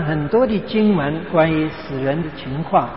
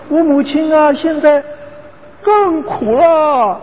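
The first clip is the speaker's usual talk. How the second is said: higher, slow and pained wife's lament; grieving wife; 8 semitones higher, slower than usual. Retold or imitated. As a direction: mourn it like a daughter in anguish. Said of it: "哭得更厉害".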